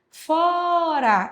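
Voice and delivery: Fora with FO held long, high; stressed syllable demo. Shown as a demonstration. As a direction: sing out the FO of Fora to show the stress. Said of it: "ela é paroxítono". (Fora com o FO bem alongado e agudo)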